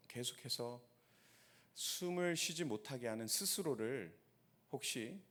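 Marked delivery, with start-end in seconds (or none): 0.1-0.8 softer echo. Real, not acted.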